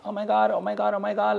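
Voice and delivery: high, excited mock fangirl squeal; mock gushing fans. Mocking people who swarm her, high squeal. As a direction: gush like an overeager fan. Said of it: "people be coming up to me being like".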